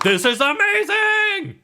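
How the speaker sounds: squealed high, thrilled crewman; Todd. Acted. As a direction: shriek it with over-the-top delight. Todd cheers from the cannon.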